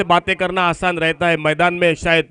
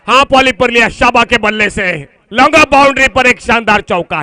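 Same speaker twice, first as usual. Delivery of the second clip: excited cricket commentary; 4 semitones higher, 5 dB louder than usual. Real, not acted. Boundary hit, live commentary.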